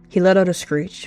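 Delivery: ordinary delivery, nothing marked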